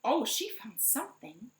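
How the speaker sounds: plain reading voice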